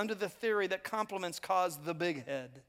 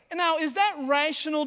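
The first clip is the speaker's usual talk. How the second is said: ordinary talk, nothing marked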